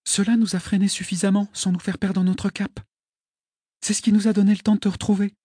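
lightly voiced high young girl. A narrator's reading. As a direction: explain calmly as a girl. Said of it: "me dit-elle".

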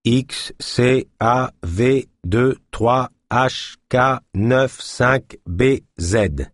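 spelled out very slowly; each character slow and separate; slow spelling. Acted. Spelling out an access code.

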